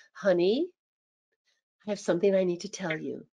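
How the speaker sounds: soft, low and gentle; gentle and caring. Shown as a demonstration. Spouse breaking uncomfortable news, soft and gentle. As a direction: ease the bad news in tenderly. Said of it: "if I sound gentle and caring".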